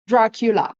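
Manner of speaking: Dracula with an English r from the back of the mouth. Shown as a demonstration. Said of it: "In English".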